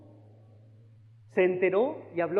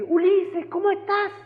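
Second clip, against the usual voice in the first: sister Carol's greeting; 12 semitones higher, 6 dB louder, slower than usual. Retold or imitated. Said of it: "la hermana Carol me recibe".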